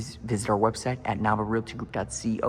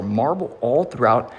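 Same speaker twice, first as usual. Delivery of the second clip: ordinary talk, nothing marked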